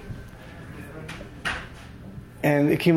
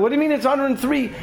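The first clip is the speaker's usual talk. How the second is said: mock yell; 7 semitones higher than usual. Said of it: "the guy starts yelling".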